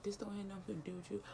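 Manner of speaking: quiet, fast, breathy brush-off; soft mock-dismissive murmur; dismissive hush. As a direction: wave her off under your breath. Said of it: "And he like".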